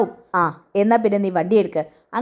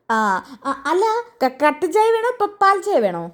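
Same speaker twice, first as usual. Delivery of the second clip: high-pitched deaf tea seller asking again; deaf old shopkeeper; 8 semitones higher than usual. Acted. Deaf tea shop owner voiced. (ചെവി കേൾക്കാത്ത ചായക്കടക്കാരൻ്റെ ഉയർന്ന ശബ്ദം)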